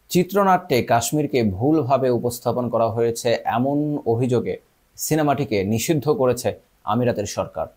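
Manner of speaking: normal talking voice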